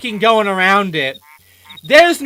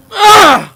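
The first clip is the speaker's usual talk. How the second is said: 2 loud, high exasperated ugh; 3 let out a fed-up ugh; 4 pained cry of frustration; 1 frustrated groan; 10 semitones higher, 12 dB louder than usual. Real, not acted. Frustrated outburst.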